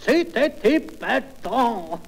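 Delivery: drawn-out, high cry ending in a laugh; extase comique. Acted. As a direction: stretch it out in hammed-up delight. Cri du marié en pleine action. (ravissement surjoué, très étiré)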